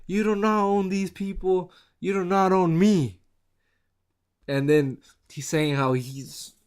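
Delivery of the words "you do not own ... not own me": raised-pitch mock Rock, stressing not; lightly voiced Rock putting someone in their place; The Rock imitation. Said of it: "The Rock saying".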